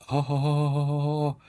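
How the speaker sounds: long, high held "oh"; one sustained high note of revelation; awed "oh". Retold or imitated. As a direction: sing out a drawn-out high "oh" of wonder. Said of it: "holding my daughter was just like".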